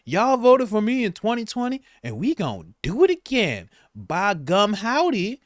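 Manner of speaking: mock a politician's put-on Southern twang; exaggerated fake down-home twang; fake Southern accent. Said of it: "she's like".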